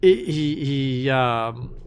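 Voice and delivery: normal talking voice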